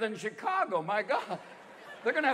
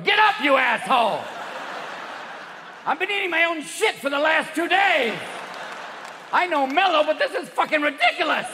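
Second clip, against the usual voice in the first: loud, high ranting cat; hammed-up outraged cat shouting; angry cat; 7 semitones higher, 7 dB louder than usual. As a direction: holler like a fed-up cat. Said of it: "your cat's going".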